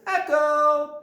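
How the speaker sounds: high, loud call coming back; echoed yell. Shown as a demonstration. The canyon answers the yeller, high echoed shout. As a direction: mimic a canyon echo, high and long. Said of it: "and then you hear".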